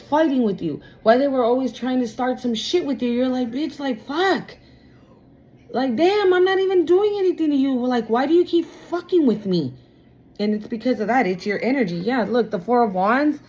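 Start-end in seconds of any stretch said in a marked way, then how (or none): 3.5-9.7 high-pitched fed-up protest; acted-out indignant complaint; exasperated. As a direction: plead in exasperation, pitched well up at first. Voicing the listener fed up with attackers.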